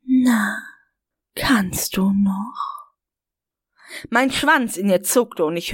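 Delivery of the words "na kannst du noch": slow breathy whisper in his ear; cupped hand whisper. A narrator's reading. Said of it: "flüsterte mir ins Ohr".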